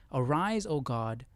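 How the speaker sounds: ordinary delivery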